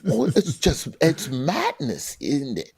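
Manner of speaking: mock British tut-tutting; hammed-up British small talk, amused; British dismay. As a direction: do a put-on British accent, aghast. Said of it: "find the British to sound so delightful".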